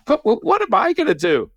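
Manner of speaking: high-pitched stammered question; acted helpless protest. Reluctant skeptic pushed to examine a foot, high flustered protest. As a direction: squeak out a flustered objection. Helpless reply to insistent mother.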